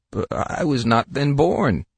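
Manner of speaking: mournful lamb. A narrator's reading. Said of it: "bleated the lamb in a mournful tone of voice".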